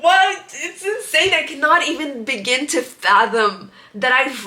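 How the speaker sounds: high, loud indignant "What?"; incredulous outburst. Real, not acted. To her viewers, answering readers who shrug it off, high and loud in disbelief.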